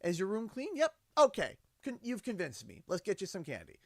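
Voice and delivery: high, upbeat giving in; cheerfully won over; mock-convinced dad. Dad telling his daughter she convinced him, high and cheerful. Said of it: "dad to daughter".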